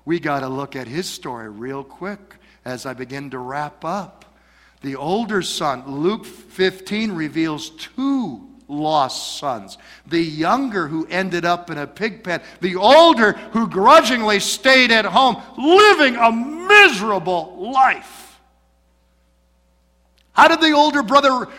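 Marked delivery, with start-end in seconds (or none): none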